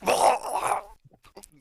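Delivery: loud guttural death noise; guttural cry. Acted. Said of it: "guttural just".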